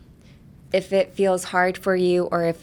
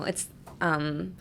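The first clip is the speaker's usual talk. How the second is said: ordinary talk, nothing marked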